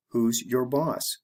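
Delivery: slow, careful "Who's your boss?" with "your" said as a full separate word; deliberately slow teacher model. Shown as a demonstration. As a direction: spell it out slowly, word by word. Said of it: "I'll say it the slow way".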